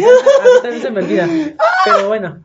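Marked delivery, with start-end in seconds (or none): 0.0-1.3 laughing. Real, not acted. Laughs at forgetting the name.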